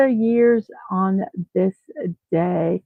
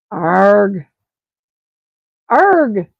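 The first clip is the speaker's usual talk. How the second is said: frustrated groan; 3 semitones lower, 7 dB louder than usual. Real, not acted. Frustrated at screen.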